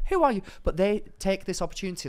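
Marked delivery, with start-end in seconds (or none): 0.1-0.4 higher, mocking rude question. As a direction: toss it up high, mocking the stranger. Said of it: "I wouldn't be like".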